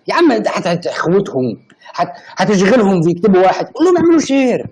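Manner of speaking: high, loud, rising protest; loud objection. Real, not acted. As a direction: cut in loudly to stop the plan. Objecting to chat instruction.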